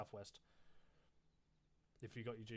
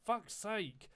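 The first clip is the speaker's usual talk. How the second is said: high, loud burst of exasperation; exasperated outburst; 8 semitones higher, 6 dB louder than usual. Real, not acted. Squad leader interrupted while organising his men, exasperated. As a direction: curse it sharply, pitched well up.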